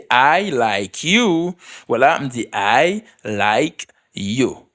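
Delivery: every word said slowly and fully; slow and clear. Shown as a demonstration. Teacher models a practice sentence, very slowly. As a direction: spell out each word, very slow. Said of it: "Se konsa pwononse l".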